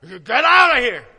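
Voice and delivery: loud, forceful shout out; loud command. Pretend. Jesus driving the traders out with a shout. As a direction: drive them out with a bellow. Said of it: "that's not how".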